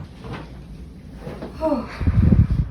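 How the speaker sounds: long breathy sigh of relief; relieved sigh. Real, not acted. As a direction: let out a big relieved sigh. Nose packing just removed.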